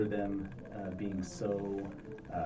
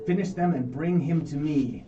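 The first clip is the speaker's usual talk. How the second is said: high, loud insect chitter; 5 semitones higher, 6 dB louder than usual. Acted. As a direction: chitter it out like a bug creature. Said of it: "she says in this chittering, uh, voice".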